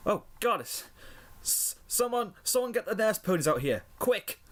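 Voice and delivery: high-pitched, stammering shock; gasped alarm. A narrator's reading. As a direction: call urgently for help in a high panicked voice. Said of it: "into the bakery and gasped".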